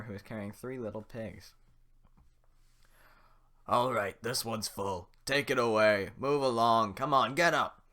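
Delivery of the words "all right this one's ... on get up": loud commanding guard; barking guard. Acted. The guard herds prisoners away, loud and commanding. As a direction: bark orders down the line.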